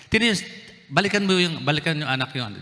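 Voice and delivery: usual speaking voice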